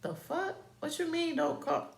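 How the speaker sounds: high-pitched mock indignation; indignant retort. Voices his indignant reaction.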